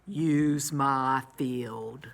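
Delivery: low, very slow farmer voice; a slow old farmer offering help; farmer's voice. A narrator's reading. Farmer Seed offers his field, low and slow. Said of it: "and Farmer Seed said".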